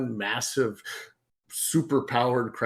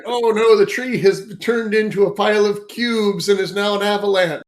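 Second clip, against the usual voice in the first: loud, high, hammed-up alarm; playful panicked wail, pitched way up; mock panic; 9 semitones higher, 8 dB louder than usual. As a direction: cry out in comic dismay. Acted panic at wild magic.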